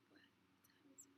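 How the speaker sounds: a barely voiced whisper, slow, imitating the man; whispered to himself. Man at the bus stop whispering the time question to himself. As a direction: murmur it under your breath like talking to yourself. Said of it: "literally that quiet".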